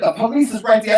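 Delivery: high-pitched retold warning; warning mother. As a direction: imitate a mother urgently cautioning. Mother voiced in retold quarrel.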